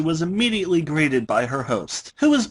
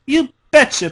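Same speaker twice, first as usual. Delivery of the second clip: higher, louder eager shout; eager reply; 12 semitones higher, 6 dB louder than usual. Acted. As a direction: pump yourself up and answer. Voicing Rainbow Dash's eager reply.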